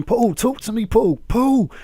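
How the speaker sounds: high, loud hammed-up calls to a fallen friend; hammed-up high pleading shout; mock pleading. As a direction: cry his name in fake desperation.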